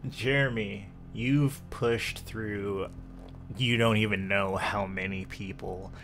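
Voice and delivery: usual speaking voice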